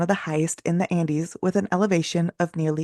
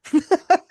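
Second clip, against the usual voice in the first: burst of laughter; 12 semitones higher, 7 dB louder than usual. Real, not acted. Laughing at being poor.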